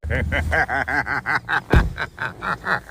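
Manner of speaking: a burst of laughter; a real, amused laugh. Real, not acted. Laughing after the rant.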